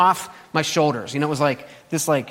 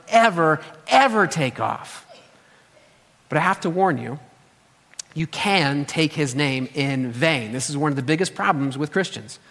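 normal talking voice

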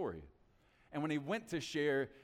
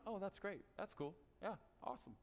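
bored brush-off; 5 semitones higher, 8 dB quieter than usual. Shown as a demonstration. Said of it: "No, no, no".

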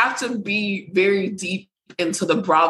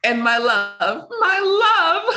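mock dramatic lover; 10 semitones higher than usual. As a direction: ham it up like a stage play. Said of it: "Like she's in a fucking Romeo and Juliet".

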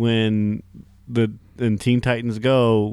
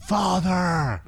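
anguished shout; 10 semitones higher than usual. Pretend. Anguished cry for father parody.

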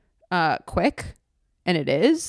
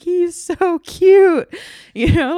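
high, loud, drawn-out squeal of adoration; gushing adoration; 11 semitones higher, 8 dB louder, slower than usual. Retold or imitated. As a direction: gush over a newborn, stretching it out high. Retold gushing over her newborn baby.

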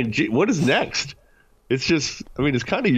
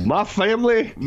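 put-on Southern accent, pitched high; hammed-up Southern twang; Brian Kelly; 10 semitones higher than usual. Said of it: "sounding just like Brian Kelly".

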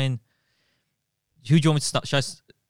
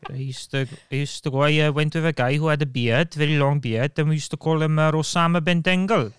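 imitated Swansea Welsh storyteller; Swansea accent. Shown as a demonstration. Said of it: "that was spot on".

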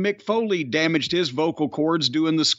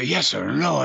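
short-burst impression; 3 semitones lower than usual. Mocking an ailing boss's speech, short low bursts. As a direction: lower voice forced out in a short burst. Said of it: "in the short bursts where".

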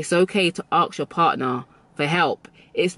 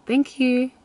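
much higher sugary "Thank you"; sweet thank you; 8 semitones higher than usual. Shown as a demonstration. Showing the art of being sweet, high and sugary. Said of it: "I have mastered the art of being sweet".

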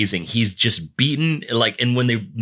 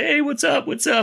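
much higher, sing-song what's up; cocky greeting. Retold or imitated. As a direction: breeze past everyone casually. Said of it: "It's like".

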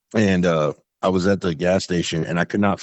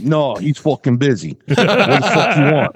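slow, raised mobster voice; mob movie tough guy; 8 semitones higher, 5 dB louder, slower than usual. Retold or imitated. Business owner fending off loan shark callers, mob movie voice. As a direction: act a pushy movie mobster, higher and slow. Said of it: "I love mob movies".

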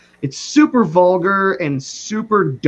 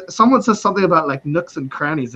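ordinary delivery

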